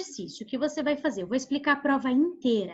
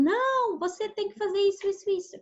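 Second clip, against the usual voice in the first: high, nagging scold, much higher than her own voice; mock scolding; 8 semitones higher than usual. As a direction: play the bossy women telling him off. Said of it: "que brigaram com ele".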